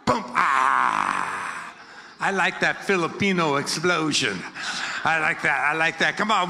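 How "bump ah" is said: low, sudden blast sound; low burst on the bump; explosive grunt. Shown as a demonstration. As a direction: make it explode. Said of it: "I want to explode it".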